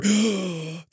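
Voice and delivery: exaggerated shocked gasp; put-on scandalized intake of breath; mock offended gasp. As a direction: gasp as if deeply insulted. Mock offended crowd gasps again.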